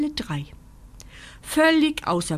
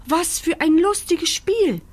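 hohe, lebhafte Kükenstimmen; schnatternde Entenküken; 9 semitones higher, 4 dB louder than usual. A narrator's reading. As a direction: schnattern wie kleine Enten. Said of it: "schnattern die Entenküken". (schnatternd wie Entenküken)

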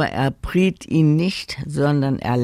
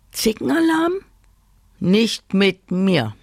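a little bird scoffing to himself; cheeky bird voice; 7 semitones higher than usual. Acted. Budgie Willi scoffs at the bossy new girl. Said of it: "dachte Willi".

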